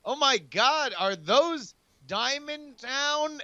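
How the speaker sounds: high, drawn-out fake alarm; feigned astonishment. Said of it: "but first I say".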